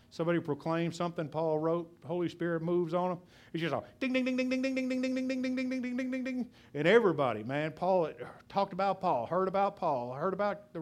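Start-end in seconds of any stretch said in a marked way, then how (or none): 4.1-6.5 slot machine bell. Retold or imitated. Pastor imitating a slot machine bell ringing for Paul in heaven, high sing-song dings. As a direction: ring it out high and bright like a slot machine paying out. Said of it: "his bell ought to be ringing all the time".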